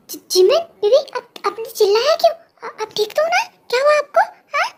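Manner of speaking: worried panic. Acted. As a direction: rush in frightened for her. Jungkook panics over Jimin crying.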